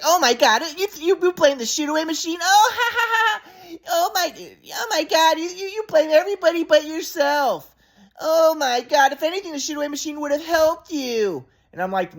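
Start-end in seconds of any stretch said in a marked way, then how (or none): 0.0-2.4 high, incredulous mock-mother voice; mocking mom imitation. Son mimicking mom dismissing him, high mocking voice. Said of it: "but then she's like". 2.4-11.5 very high mocking voice with fake cackling; derisive mom imitation. Son mimicking mom blaming him, high derisive mockery. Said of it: "but then she's like".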